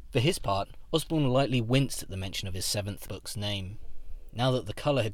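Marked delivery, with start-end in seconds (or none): none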